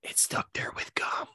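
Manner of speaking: hushed conspiratorial whisper. Acted. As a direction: whisper it like a secret. Conspiratorial joke about Congress.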